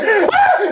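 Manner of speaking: very high, short imitation scream; a squealed scream, half laughing; mock scream. Game master imitating her bloody-murder scream, high mock shriek.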